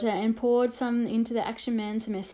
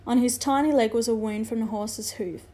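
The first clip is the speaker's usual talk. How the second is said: plain reading voice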